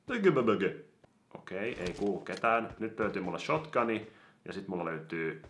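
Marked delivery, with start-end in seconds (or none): 0.1-0.8 higher, odd goofy voice. Pretend. Repeating the silly word in a goofy voice. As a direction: twist it into a cartoonish gurgle.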